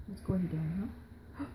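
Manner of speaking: ordinary talk, nothing marked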